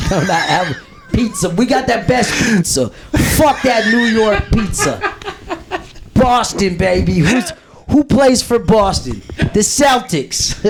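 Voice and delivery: high-pitched, hammed-up Boston accent. Comedian boasting of Boston pizza, mock Boston accent. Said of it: "how do you do a Boston accent".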